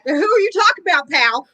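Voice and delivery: high mock Southern challenge; Southern accent. Confronting a Texan in mock Southern accent. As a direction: puff up like a Southern tough guy. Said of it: "gotta turn it on for him".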